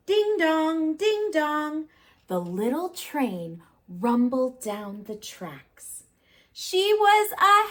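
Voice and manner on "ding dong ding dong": ding dong bell. Acted. Train bell sound.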